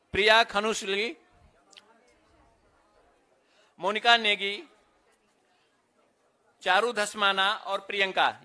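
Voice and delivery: measured announcer voice, each name set apart; roll-call announcer. Real, not acted. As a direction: call out names at a ceremony.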